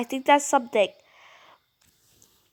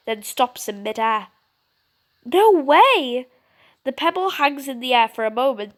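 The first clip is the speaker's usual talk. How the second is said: ordinary talk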